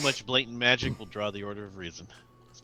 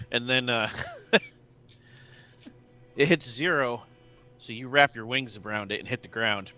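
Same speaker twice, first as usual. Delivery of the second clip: ordinary talk, nothing marked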